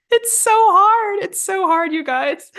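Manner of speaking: high-pitched playful complaint, SO stretched; laughing whine. Real, not acted. Teacher fumbles February in front of viewers, high laughing whine. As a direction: whine it high through amusement. Mock whining after fumbling the word.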